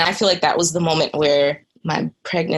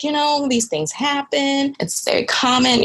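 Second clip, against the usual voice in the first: dismissive doctor; 10 semitones higher than usual. Retold or imitated. Doctor shrugging off a patient's miscarriage, breezy and higher. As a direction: play down her loss like it's nothing. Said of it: "She was just kind of like".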